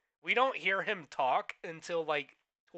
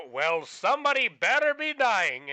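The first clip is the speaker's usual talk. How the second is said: loud; 7 semitones higher, 10 dB louder than usual. Retold or imitated.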